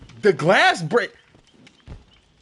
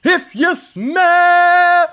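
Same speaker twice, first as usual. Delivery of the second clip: much higher, drawn-out If You Smell; Rock catchphrase; 10 semitones higher, 8 dB louder, much slower than usual. Retold or imitated. Imitating the Rock's entrance call, stretched out.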